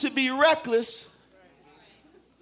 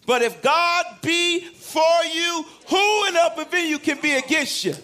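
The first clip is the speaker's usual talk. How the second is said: full-force preaching; 6 semitones higher than usual. Real, not acted. Preaching climax.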